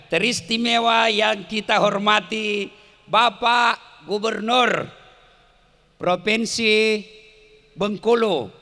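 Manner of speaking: slow, projected ceremonial address with pauses; formal salutation. Real, not acted. Preacher formally honours the governor before the crowd, ceremonial address. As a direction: proclaim each title grandly, phrase by phrase.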